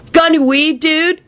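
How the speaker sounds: high, pushy slacker voice; a rude dude demanding; demanding stoner. Acted. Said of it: "he demanded".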